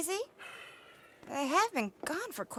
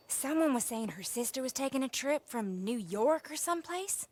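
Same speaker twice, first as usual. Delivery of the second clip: ordinary delivery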